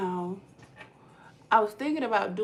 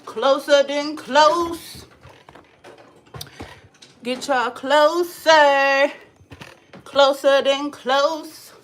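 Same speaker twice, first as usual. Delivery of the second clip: loud, slow, stretched closer than close; slow and drawn out; 4 semitones higher, 9 dB louder, much slower than usual. Shown as a demonstration. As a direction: drag out every word as the camera moves in. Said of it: "I wonder if I could get y'all".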